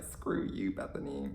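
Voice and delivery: soft, unvoiced mock curse; muttered insult. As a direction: grumble it under your breath. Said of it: "I was like".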